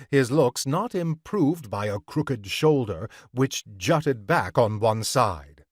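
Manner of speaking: usual speaking voice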